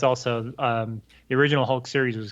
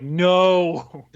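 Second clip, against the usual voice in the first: a louder, higher, emphatic no; firm mock no; 5 semitones higher, 5 dB louder than usual. Friends telling Rick not to tell Bruce, emphatic no. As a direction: shut the idea down flat. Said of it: "And they're like".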